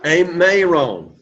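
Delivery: Amayron said slowly, each syllable clear; slow model. Shown as a demonstration. Teacher repeating Amayron slowly for the class. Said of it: "Very good".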